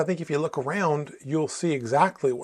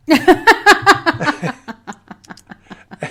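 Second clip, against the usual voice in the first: loud high burst of laughter; big laugh; 12 semitones higher, 10 dB louder than usual. Real, not acted. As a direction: crack up loudly at the punchline. Laughing at the punchline.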